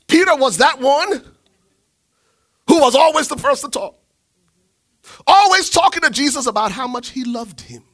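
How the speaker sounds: loud, high preacher build; preaching at full force. Acted. Preacher lifting the church, full force. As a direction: drive it home to the congregation.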